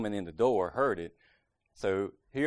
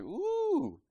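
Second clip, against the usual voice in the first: high squeaky startled ooh; a scared squeak at the sight of her. Retold or imitated. Husband caught by his angry wife, squeaky startled ooh.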